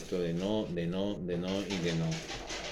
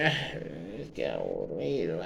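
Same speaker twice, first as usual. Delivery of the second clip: low, soft, bored whine; a lazy, unimpressed grumble; mock bored sigh; 4 semitones lower, 4 dB quieter than usual. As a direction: play the yawning churchgoer. Churchgoer bored by scripture.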